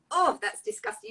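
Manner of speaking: retelling her own disgusted reaction to an oil; mock disgust. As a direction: act out recoiling from a foul smell. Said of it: "and I went".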